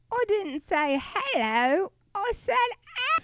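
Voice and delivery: squeaky little British worm impression; Labyrinth worm. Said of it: "do the grubworm from Labyrinth".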